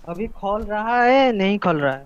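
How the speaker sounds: loud, slowed-down question, drawn out. Real, not acted. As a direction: repeat it loudly for someone who didn't hear. Repeats question after listener's "huh?.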